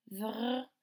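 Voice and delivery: "vr" as a buzzing v running into French r, no vowel; V-R blend. Shown as a demonstration. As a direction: sound out "vr" without any vowel. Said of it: "C'est le V R qui fait".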